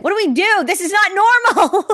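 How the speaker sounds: high, loud exasperated wail; panicked outburst. Real, not acted. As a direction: cry it out in mock despair. Panicked outburst about show ending.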